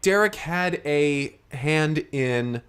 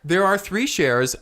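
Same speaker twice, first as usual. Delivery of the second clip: higher-pitched, exasperated insistence; 7 semitones higher, 3 dB louder than usual. Acted. Theater owner explaining the shares once more, exasperated. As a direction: spell it out, pitch raised in frustration. Exasperated repeat after not being understood.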